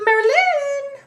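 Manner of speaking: high, loud, stretched-out call across the room; calling out a name. Shown as a demonstration. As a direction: yell her name like hailing a friend. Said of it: "That's how I say it".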